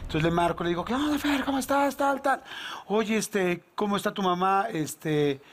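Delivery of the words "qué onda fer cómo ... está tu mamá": bright raised greeting voice; higher, chummy phone hello; friendly greeting. Retold or imitated. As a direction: put on a cheery phone voice. Friendly phone call to the son.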